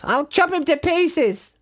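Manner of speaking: high, slow menacing farmer; vicious shout. Acted. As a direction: gloat about chopping him. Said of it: "shouted Bunce".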